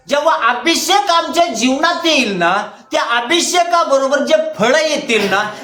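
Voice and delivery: high-pitched, insistent sermon delivery; fervent preaching. Real, not acted. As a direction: preach with rising urgency to the congregation. Preaches with raised voice.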